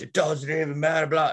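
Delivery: high-pitched imitation of a ranting man; mock screaming rant. Retelling a screaming guy at a stoplight, high mock rant. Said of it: "like, screaming".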